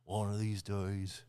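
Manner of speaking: a lightly done Southern accent mocking the actor. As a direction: play a bad Southern accent, earnest. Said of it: "terrible Southern accent".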